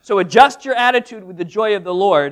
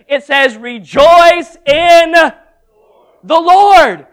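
slow, louder, drawn-out rise to the Lord; louder slow proclamation; preaching climax; 5 semitones higher, 11 dB louder, much slower than usual. Real, not acted. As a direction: build and land hard on the Lord.

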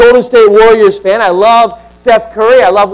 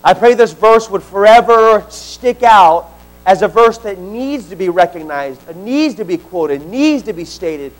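soft, lower prayer voice; 5 semitones lower, 3 dB quieter than usual. Acted. Pastor praying over congregation, soft and low. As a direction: pray quietly over the room.

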